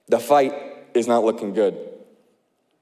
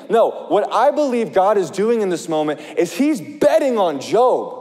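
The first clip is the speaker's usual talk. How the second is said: ordinary delivery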